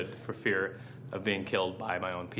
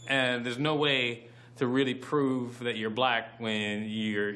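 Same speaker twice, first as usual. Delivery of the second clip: ordinary talk, nothing marked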